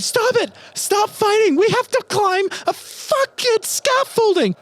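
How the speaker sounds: very high, loud shrieked plea; screaming. Acted. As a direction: scream at them to stop, full force. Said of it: "Sora's screaming".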